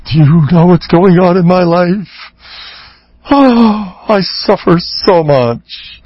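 high, slow, put-on moaning; milk the self-pity, hammed-up; whiny sufferer. Rabbi mimicking a self-pitying spouse, whiny.

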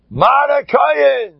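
the Chazon Ish's drawn-out ecstatic cry. Retold or imitated. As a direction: call it out in rapture. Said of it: "He went wild".

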